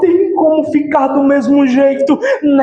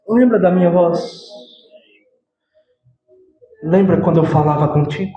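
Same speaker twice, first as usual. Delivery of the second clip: Jesus speaking; 8 semitones lower, 4 dB quieter, faster than usual. Acted. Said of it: "chega e diz pra nós".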